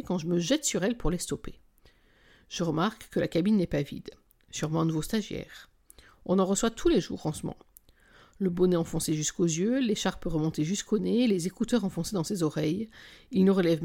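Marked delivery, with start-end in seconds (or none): none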